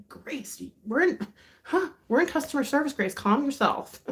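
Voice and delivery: high-pitched, flustered mock scolding; hammed-up "mind yourself" plea, pitched high; scandalized reproach. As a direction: imitate being shocked at a friend's outburst. Acts out scandalized plea to friend.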